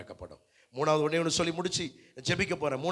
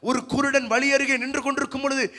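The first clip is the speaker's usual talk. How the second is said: high, loud pulpit voice; full-force preaching; 8 semitones higher, 6 dB louder than usual. Acted. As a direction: drive the Bible story towards the congregation, high and insistent. (உரத்த குரலில் பிரசங்கம்)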